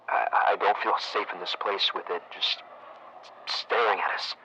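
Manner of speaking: usual speaking voice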